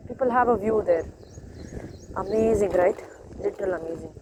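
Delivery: ordinary talk, nothing marked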